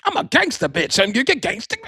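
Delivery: high, hammed-up gangster-girl threat; mock Cassie threat. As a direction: play Cassie shrieking a threat down the phone. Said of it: "threatening one of them sex workers".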